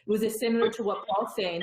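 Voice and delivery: ordinary talk, nothing marked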